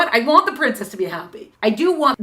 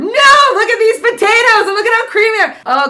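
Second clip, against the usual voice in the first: proud excited farmer; 12 semitones higher, 9 dB louder than usual. Acted. Farmer insisting on potatoes.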